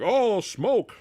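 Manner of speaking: lightly mocking the villain's reaction; mock dramatic gasp. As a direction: play it like a cheesy movie beat. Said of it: "he's like".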